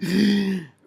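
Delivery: loud, high intake of breath; sharp gasp. Real, not acted. Telling how things really happened, a loud gasp. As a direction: gasp hard and suddenly. Gasps amid account of commission.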